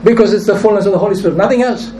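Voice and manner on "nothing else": ordinary talk, nothing marked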